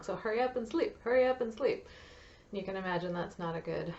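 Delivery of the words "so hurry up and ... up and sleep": mock-urgent inner voice, higher and punched; urgent self-talk. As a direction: imitate an impatient plea to yourself. Acting out a mother's pressured bedtime thoughts.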